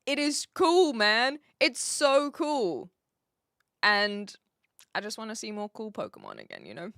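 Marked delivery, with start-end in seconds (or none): none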